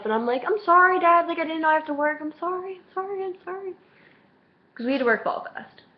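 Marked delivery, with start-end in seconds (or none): 0.4-3.7 pleading apology. Retold or imitated. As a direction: plead in a small high voice, trailing away. Acted apology to dad, fading.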